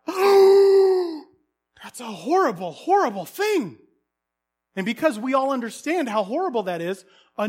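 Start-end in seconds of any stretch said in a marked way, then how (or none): 0.0-3.8 gasping, slowed, higher and louder horror; mock horror. Preacher acting shocked the host has no bread. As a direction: play up the shock at the shameful host.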